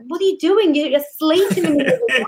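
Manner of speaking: high scolding mother-in-law; nagging mother-in-law. Retold or imitated. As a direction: scold him for sleeping, high. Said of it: "and is like".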